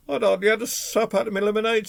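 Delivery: aggrieved robber character; complaining robber. A narrator's reading. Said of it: "robbers started complaining".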